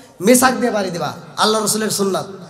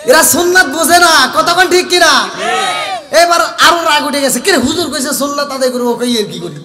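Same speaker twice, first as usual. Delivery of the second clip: louder, higher, laughing rant; mock angry husband; 6 semitones higher, 6 dB louder than usual. Preacher acting the husband fuming at his wife, laughing.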